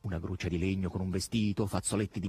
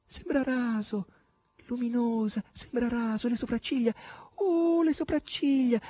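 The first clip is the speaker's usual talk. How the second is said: rapturous crying out; 13 semitones higher than usual. A narrator's reading. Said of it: "baciandola e gridando".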